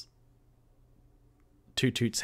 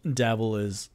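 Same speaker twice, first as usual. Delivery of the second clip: ordinary delivery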